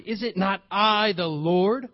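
ordinary talk

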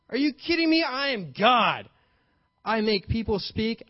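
ordinary delivery

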